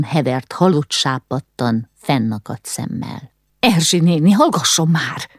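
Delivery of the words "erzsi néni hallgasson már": a mild shrieked reprimand; snapped order. A narrator's reading. Ilka to the wailing cook, mildly snapped order. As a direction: cut in to silence the cook, voice only slightly raised. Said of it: "rivolt a szakácsnőre".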